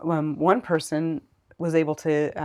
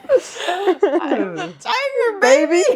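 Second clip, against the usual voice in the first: much higher, slow, laughing through the line; laughing sing-song; 10 dB louder, much slower than usual. Real, not acted. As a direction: belt it out while cracking up. Jokingly sings the Rocky line.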